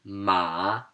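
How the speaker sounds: MA dipping down, then back up; third tone. Shown as a demonstration. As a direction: say "ma" with a falling-rising pitch. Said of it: "let me give you an example".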